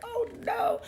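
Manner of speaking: terrified bride's squeal; panicked squeal. Retold or imitated. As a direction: squeal it while running in terror. Haley panics at the gun.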